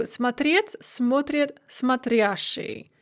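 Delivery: смотреть and смотрящий stressed on second syllable, смотрят on first; shifting stress contrast. Shown as a demonstration. Teacher contrasts смотреть, смотрят, смотрящий, showing where stress falls. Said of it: "stress is the same as it is in the infinitive".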